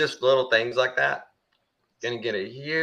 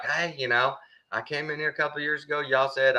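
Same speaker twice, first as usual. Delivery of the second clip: put-on old man at the tax office; 6 semitones higher, 4 dB quieter than usual. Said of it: "He's like".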